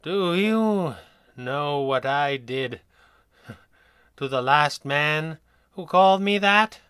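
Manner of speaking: a croaking, tired inmate with a dark chuckle; slow, croaking menace from a drugged madman. Acted. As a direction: croak it out lazily, half laughing. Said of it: "a tired-sounding voice croaked passively".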